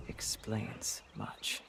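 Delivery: slow near whisper; hushed realization. Acted. Knight taking in proof of Lochner's plan, slow hushed whisper. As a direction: let it sink in, barely voiced. Knight absorbing grim news.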